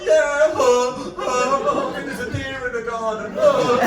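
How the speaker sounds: loud, high mock sob through the words; sobbing. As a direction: blubber out that you're fine. Sobbing while claiming fine.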